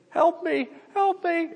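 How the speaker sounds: very high, drawn-out mock whine; hammed-up high pleading of a little girl; whiny child imitation. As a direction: whine like a helpless drama-queen toddler. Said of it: "she'll get in a really whiny voice".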